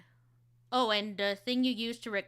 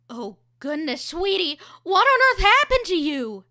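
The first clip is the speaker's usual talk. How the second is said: higher, loud fussing mother; worried mom; 7 semitones higher, 13 dB louder than usual. Shown as a demonstration. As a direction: fuss over a messy child. Second take, mom.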